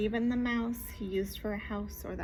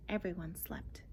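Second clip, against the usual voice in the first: soft hushed refrain; whispering; 5 semitones lower, 10 dB quieter than usual. Acted. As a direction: hush it like a lullaby.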